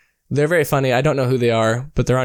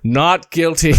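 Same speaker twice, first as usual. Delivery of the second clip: judge voice; 3 semitones higher, slower than usual. Pretend. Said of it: "I have to play the judge too".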